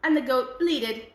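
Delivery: plain reading voice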